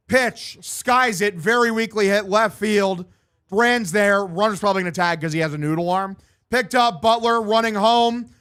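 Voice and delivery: brisk sportscaster call of the hit; live play-by-play. Acted. Gamer calling his own ballgame, announcer style.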